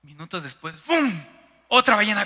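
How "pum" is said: sudden loud "pum" boom; imitated whale burst. Storyteller as a whale suddenly leaps, explosive sound effect.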